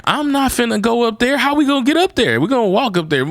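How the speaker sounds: high-pitched comic incredulity; flabbergasted. Acted. Hiker thinking about climbing to the tower, flabbergasted. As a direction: protest in rising, mock disbelief. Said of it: "That's how flabbergasted I was".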